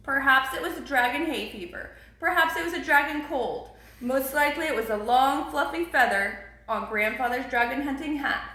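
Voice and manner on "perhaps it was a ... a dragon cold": ordinary delivery, nothing marked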